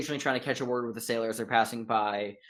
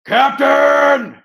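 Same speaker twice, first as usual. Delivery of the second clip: shouted call; 14 dB louder than usual. Acted. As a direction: bellow it loud and high. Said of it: "glances over his shoulder and goes".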